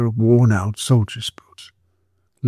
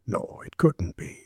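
hushed disbelief; 4 semitones lower, 11 dB quieter than usual. A narrator's reading. Constable doubting to himself.

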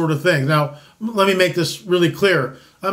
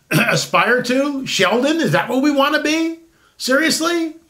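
incredulous; 7 semitones higher than usual. Real, not acted. Incredulous rhetorical questions.

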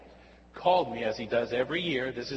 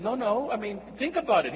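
higher, pressing insistence; insisting friend; 8 semitones higher, faster than usual. Retold or imitated. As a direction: push him to reconsider.

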